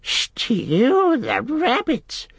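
very slow, high creature squeal; dismayed squeal. A narrator's reading. Gollum hears Sam will stew rabbits, dismayed squeal. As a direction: stretch it into an appalled squeal. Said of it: "squealed Gollum in dismay".

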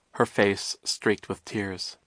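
ordinary delivery

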